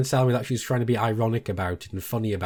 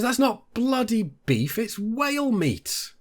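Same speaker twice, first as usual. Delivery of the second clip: mimicking the wife; 12 semitones higher, slower than usual. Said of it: "She goes".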